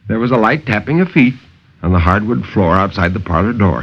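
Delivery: ordinary delivery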